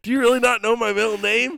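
high, loud, incredulous question; loud disbelief. Real, not acted. Friend keeps guessing the middle name wrong, incredulous. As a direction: pitch it way up, appalled but amused.

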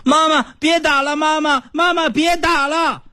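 put-on high crying child's plea; sleep talking. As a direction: whimper like a beaten child in sleep. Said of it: "老大说梦话".

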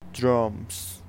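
usual speaking voice

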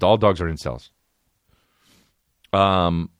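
ordinary talk, nothing marked

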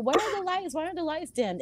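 high, softened mock alarm; retold stage panic. Singer thrown by the lights, retold in a high worried voice. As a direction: fret in a small high voice.

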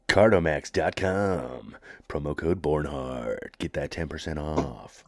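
slow, low ad-read announcer; radio infomercial voice. Pretend. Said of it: "that radio infomercial voice".

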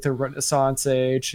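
ordinary talk, nothing marked

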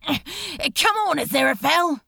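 urgent shout. A narrator's reading. Crowley urging Aziraphale to the last boat, urgent shout. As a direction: hurry him along over the noise.

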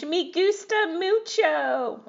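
high, slow hammed-up Spanish; mock Spanish accent. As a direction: overdo a tourist's Spanish. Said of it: "Somebody's trying to speak Spanish".

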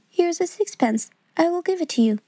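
put-on a small boy generously offering a coin; kind little boy. A narrator's reading. Boy Donald offers his sixpence.

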